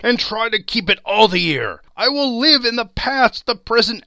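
high, loud, fervent pleading; impassioned begging at full voice; agonized vow. A narrator's reading. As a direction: swear it desperately, building to an anguished cry. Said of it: "In his agony".

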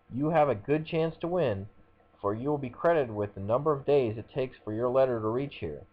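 eager pitchman, pitch lifting; ad read. Acted. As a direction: hurry them like a radio ad. Reads the contest ad aloud.

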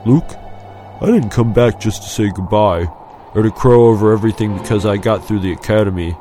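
sober, measured confiding; solemn. A narrator's reading. Friend leaning close to confide in Luke, solemn. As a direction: lead in earnestly to a weighty confession. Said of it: "unaccustomed solemnness in his friend's tone".

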